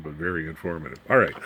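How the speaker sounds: ordinary talk, nothing marked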